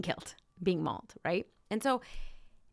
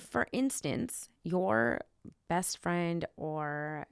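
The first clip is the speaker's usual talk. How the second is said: ordinary delivery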